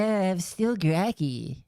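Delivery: slow, slurred drugged voice; mock sedated drawl; groggy voice. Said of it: "That's the Panda Lee drugged voice".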